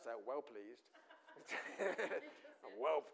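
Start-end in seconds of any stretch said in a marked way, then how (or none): 0.3-0.9 put-on "well pleased" said in a lightly laughing Scouse accent; a hint of a Scouse God, laughing. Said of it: "sounds a bit Scouse". 1.1-2.7 amused laugh. Real, not acted. Laughs at own Scouse joke.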